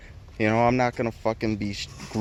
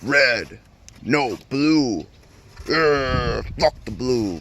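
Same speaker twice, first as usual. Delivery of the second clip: mock gang rival; 4 semitones higher, 5 dB louder, much slower than usual. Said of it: "like the Bloods and the Crips".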